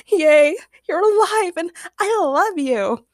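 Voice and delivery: high, slow squeal of delight; drawn-out girlish gushing; gushing joy. A narrator's reading. Agnes hugs James who is alive, gushing.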